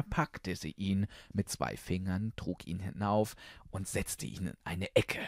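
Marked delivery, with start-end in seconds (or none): none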